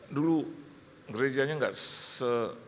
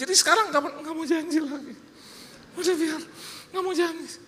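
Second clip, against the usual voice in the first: high, louder retold argument; heated quarrel; 13 semitones higher, 3 dB louder than usual. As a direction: argue it out, pitched way up. Retold quarrel about promising again. (cekcok soal janji, suara tinggi)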